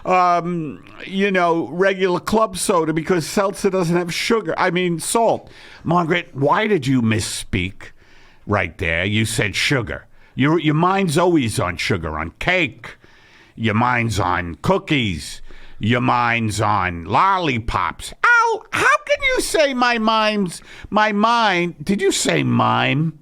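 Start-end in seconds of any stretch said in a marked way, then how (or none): 0.1-5.5 higher, irritated wife voice; the wife snapping, pitched up; Margaret voice. Acted. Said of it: "two voices that Eddie Pepitone channels". 18.2-21.8 high indignant wife voice; Margaret voice. Acted. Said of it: "two voices that Eddie Pepitone channels".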